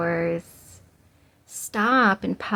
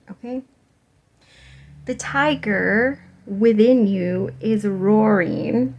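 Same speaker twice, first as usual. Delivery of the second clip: normal talking voice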